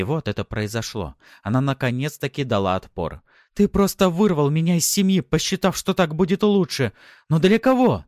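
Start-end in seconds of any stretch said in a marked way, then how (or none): 3.5-6.9 high, loud woman's outburst; furious accusation. A narrator's reading. Wife accusing husband over her family, furious. 7.3-8.0 high, loud, shouted question; furious challenge. A narrator's reading. Wife challenging her husband's motives, furious.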